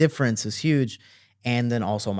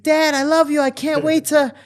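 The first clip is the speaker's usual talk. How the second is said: very high, loud mock adoration; hammed-up squealed devotion; gushing affection; 6 dB louder than usual. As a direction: gush loudly as a lovey-dovey kid. Gushing to cover friend's noisy escape.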